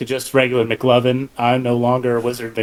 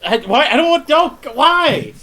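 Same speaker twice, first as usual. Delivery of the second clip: pitched way up, flustered protest; shocked squeak; 10 semitones higher, slower than usual. Acted. McLovin flashed by Olivia, squeaking a much higher flustered why.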